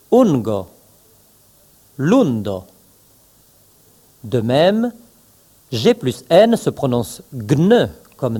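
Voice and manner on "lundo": usual speaking voice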